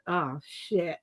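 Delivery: low, soft "Oh, shit"; dismayed whisper; dismayed mutter. Retold or imitated. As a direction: realize the damage, quietly. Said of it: "And I'm like".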